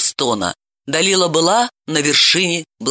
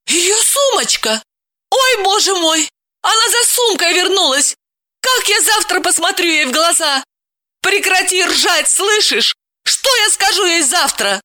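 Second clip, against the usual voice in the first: panicked wife; 14 semitones higher than usual. A narrator's reading. Sudden panic over handbag.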